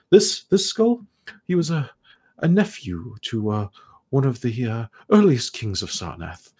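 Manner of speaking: put-on high, airy voice of a curious old elf scholar; scholarly elf ghost. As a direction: play the puzzled, polite ghost scholar, pitched high. Ghost about a skull.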